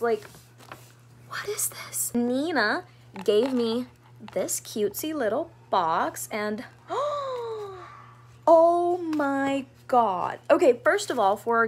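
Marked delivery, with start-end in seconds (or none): 1.3-2.1 hushed whisper of wonder; real awed whisper. Real, not acted. Peeking into a gift. 6.8-10.4 high gasp, then a slow, drawn-out oh my God; overwhelmed by the gift; real gasp and slow awe; awestruck gasp. Real, not acted. Seeing the gift box.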